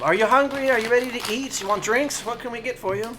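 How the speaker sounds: high, eager barmaid. Acted. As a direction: bustle up and take their order.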